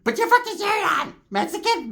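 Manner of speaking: very high squeaky put-on voice; mock scolding. Ricky telling someone to get off, high mock scolding. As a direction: scold in a cartoonish falsetto.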